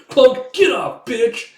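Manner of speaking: high, loud fighting shouts; furious mock yell. Coin kicking the pirate off, furious yell. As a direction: act out yelling between kicks.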